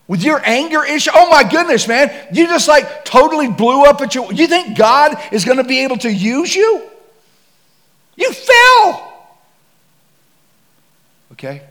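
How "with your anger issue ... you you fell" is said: loud, high, jeering accuser; mocking shout. Enemy's taunting accusations.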